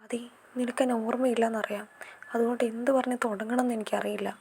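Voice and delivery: soft breathy whisper. Acted. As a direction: whisper it close, almost no voice. Intimate confession to forgetful friend.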